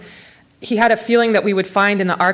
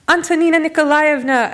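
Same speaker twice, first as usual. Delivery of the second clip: high, delighted call of a name; joyful exclaim of recognition; beaming exclamation; 9 semitones higher, 4 dB louder than usual. Acted. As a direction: greet her as if spotting a star. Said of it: "I exclaimed, beaming".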